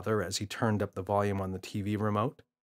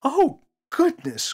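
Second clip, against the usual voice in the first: much higher, slow, drawn-out gasp of horror; a prim mother appalled; shocked cry; 8 dB louder, slower than usual. Acted. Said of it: "cried Mother".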